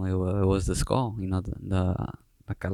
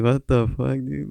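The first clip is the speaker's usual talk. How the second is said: higher-pitched retold disbelief; stunned reaction; 4 semitones higher than usual. Artist recalling his shock at being recognized, stunned. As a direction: replay your own amazement, voice lifted. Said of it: "And I was like".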